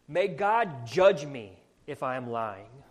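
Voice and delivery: loud, slow swearing; emphatic oath. Acted. Peter swears he is no liar, emphatic oath. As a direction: hammer out a solemn oath. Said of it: "he said something like that, like this".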